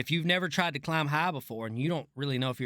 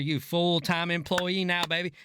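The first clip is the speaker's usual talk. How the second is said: ordinary talk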